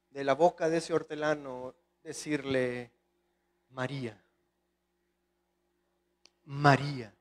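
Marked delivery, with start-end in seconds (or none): 3.7-7.1 tender call. Acted. Risen Christ softly calls María.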